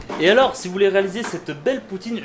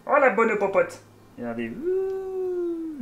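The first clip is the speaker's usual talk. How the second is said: voix de cuistot moqueur; 11 semitones higher, much slower than usual. Pretend. Se moque de sa propre cuisine.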